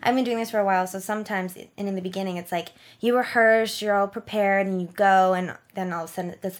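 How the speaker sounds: normal talking voice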